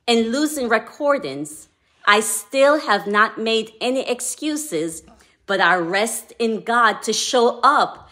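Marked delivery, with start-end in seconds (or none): none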